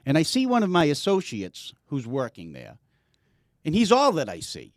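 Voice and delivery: ordinary delivery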